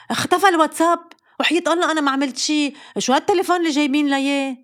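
fluster like a mom whose app vanished; panicked mother. Retold or imitated. Mother blaming the phone her kids bought, fast and flustered.

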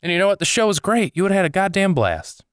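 high, rubbing-it-in tone; reproachful taunt. Real, not acted. Comedian tells no-show friends they missed a great show, high and taunting. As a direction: tell them what they missed, pointedly.

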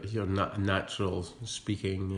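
ordinary talk, nothing marked

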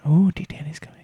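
excited whisper. Real, not acted. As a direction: breathe it as a secret.

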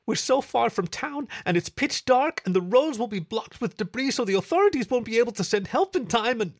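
high, loud anxious rush of words; panicked rambling. A narrator's reading. Panicked rant before Fib cuts in.

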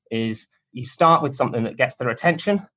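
normal talking voice

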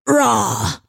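mock posh cheer; posh boyfriend. A boyfriend cheering in mock posh style. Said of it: "because he was so posh".

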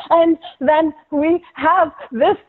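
imitating a gasping, out-of-breath anchor, high and slow; breathless, strained, slowed-down delivery; mock breathless. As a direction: wheeze the words out like you just sprinted in. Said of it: "so out of breath from running".

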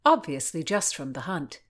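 ordinary delivery, nothing marked

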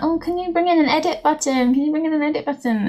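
mock whinging. Mimicking users begging Twitter for an edit button: whiny mock plea. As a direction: nag like a child asking again and again. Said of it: "people still whinging about".

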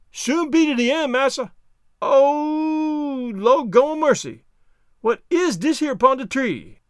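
high dialect voice with a long drawn wail; Jupiter, startled. Acted. Servant finds something on the tree, high startled wail. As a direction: cry out in alarm as the servant.

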